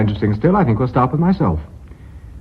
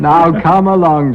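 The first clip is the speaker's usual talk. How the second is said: slow and louder, pressing him; impatient urging; 7 semitones higher, 6 dB louder, slower than usual. Acted. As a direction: drag it out, insisting. Chairman presses Jonathan impatiently.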